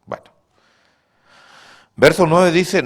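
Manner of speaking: normal talking voice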